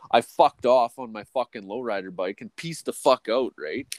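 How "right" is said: normal talking voice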